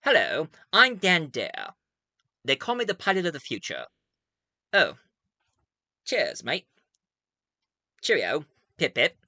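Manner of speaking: lightly put-on British accent, chipper hero; a hint of a posh British accent, greeting the audience; proper British accent. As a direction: play the dashing British pilot in a mild British accent. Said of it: "talk with a proper British accent".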